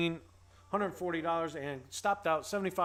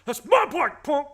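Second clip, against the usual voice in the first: much higher, loud yell; hammed-up movie shout, much higher and louder; Friday yell; 10 semitones higher, 11 dB louder than usual. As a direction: scream it like an angry kid losing his bike. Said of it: "my best, uh, Friday impression".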